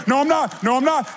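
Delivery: high, loud mock whine; whiny protest. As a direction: beg off like a kid. He refuses God.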